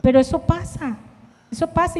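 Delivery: ordinary delivery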